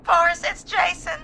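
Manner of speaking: high, upset phone caller; distraught. Acted. Caller tells host about his friend, distraught. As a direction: break bad news in distress. Breaking news of friend's death.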